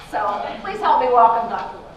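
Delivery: ordinary talk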